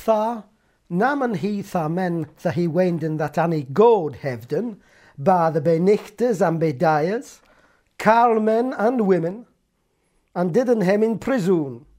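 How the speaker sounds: put-on medieval English read aloud, old vowels; Middle English reading. Shown as a demonstration. Scholar reading the Peterborough Chronicle, Middle English. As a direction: read the Chronicle as in eleven fifty-five.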